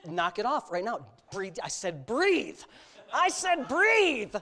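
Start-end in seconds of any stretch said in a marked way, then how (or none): 1.3-4.3 fierce dad command. Retold or imitated. Dad putting fear of God in his son, fierce. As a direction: repeat the order, each time more forceful. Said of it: "I put the fear of God in him".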